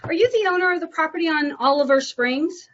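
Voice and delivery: upbeat sales caller voice; high, bright phone voice. Real, not acted. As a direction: greet the owner warmly and eagerly. Cold call phone greeting.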